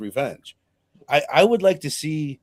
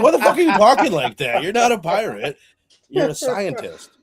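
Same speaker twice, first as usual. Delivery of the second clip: exasperated shout; 7 semitones higher, 7 dB louder than usual. Retold or imitated. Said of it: "It's like".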